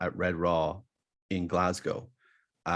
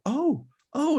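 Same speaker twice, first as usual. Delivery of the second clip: high excited squeal of recognition; excited gasp. Retold or imitated. Lady on the plane spotting him, a high excited squeal. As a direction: shriek it like you've spotted a star. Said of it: "she spots me and goes".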